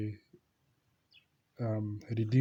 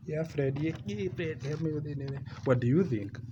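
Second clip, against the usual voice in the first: put-on girl pulling him in; 5 semitones higher than usual. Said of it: "you know, like".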